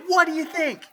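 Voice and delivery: high, loud eager ask; bright excited question to his kids. Retold or imitated. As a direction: pitch it up, eager for praise. Eager dad asks kids.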